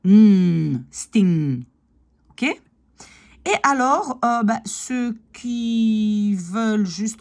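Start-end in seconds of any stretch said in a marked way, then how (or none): 0.0-1.6 sting with a held nasal ng, no g; stretched nasal ending on sting; single ng sound. Shown as a demonstration. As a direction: hum a long ng, then sting ending the same way. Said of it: "c'est un son".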